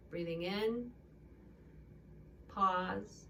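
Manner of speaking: yoga teacher guiding a sleep breathing exercise; guided meditation. Acted. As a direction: lull them into the pause between breaths.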